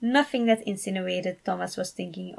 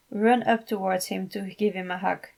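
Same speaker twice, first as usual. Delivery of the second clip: plain reading voice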